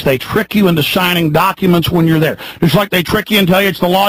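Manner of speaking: normal talking voice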